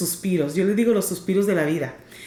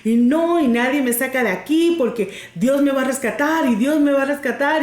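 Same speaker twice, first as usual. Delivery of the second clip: a hint of a stubborn old lady refusing to leave; stubborn old woman; 4 semitones higher than usual. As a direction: dig in her heels, drawing out the first no. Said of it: "Ella dice".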